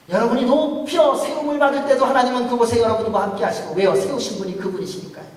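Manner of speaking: loud, high, rousing sermon climax; full-force preaching. Acted. Pastor proclaiming God's presence to the congregation, loud and rousing. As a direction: proclaim it to the back row.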